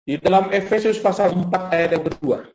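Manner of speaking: loud, booming scripture reading; forceful preaching. Acted. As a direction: proclaim the verse to the congregation. Reads scripture at full force.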